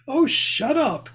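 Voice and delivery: exasperated mock yell, drawn out; retold shout. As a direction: reenact shouting at your own thoughts, half-strength. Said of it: "I actually shouted out loud".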